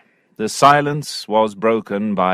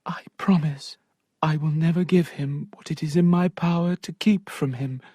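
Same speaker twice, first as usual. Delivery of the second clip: breathy whisper, higher, secretive promise; whispered vow; 6 semitones higher, 4 dB quieter than usual. Acted. Sophia whispering her promise to Tom, hushed. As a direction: keep it hushed so no one hears.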